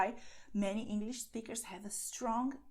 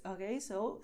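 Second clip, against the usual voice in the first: usual speaking voice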